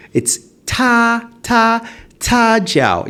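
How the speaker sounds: ta pitched high and held level, slowly. Shown as a demonstration. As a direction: hold ta up high before Jiao. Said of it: "it's high tone".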